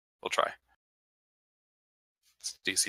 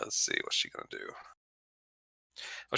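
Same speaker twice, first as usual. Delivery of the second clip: ordinary delivery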